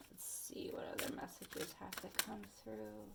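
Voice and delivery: very soft, unvoiced whisper to oneself. Real, not acted. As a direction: whisper it as you search for messages. Murmurs to self while shuffling cards.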